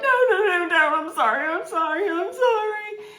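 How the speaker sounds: high, desperate begging near tears; high-pitched panicked apology with a crying edge; panicked pleading. Retold or imitated. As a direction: beg frantically, voice cracking toward a sob. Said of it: "And he's like".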